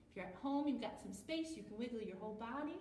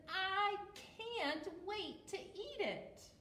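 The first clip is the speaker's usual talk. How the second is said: penguin quote; 7 semitones higher, much slower than usual. Acted. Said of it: "He says".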